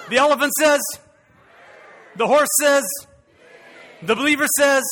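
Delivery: loud, high call-and-response preaching; rousing preacher. Real, not acted. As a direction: fire up the congregation for an answer. Preaching at full force to rouse congregation.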